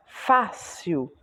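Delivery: Fácil drawn out, leaning on "Fá"; stress on first syllable. Shown as a demonstration. Teacher sounding out a word, stress on "Fá" in Fácil. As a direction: stretch Fácil out to show the strong "Fá".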